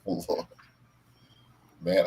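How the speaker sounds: ordinary talk, nothing marked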